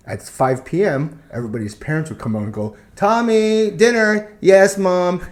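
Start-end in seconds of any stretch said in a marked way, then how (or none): 3.0-4.3 put-on high, sing-out call to a child; mimicked parent's high-pitched call. Parents call kids from the street. 4.4-5.2 lightly mimicked kid, higher and louder; dutiful kid's raised reply; obedient child answering. Child answers the calling parent.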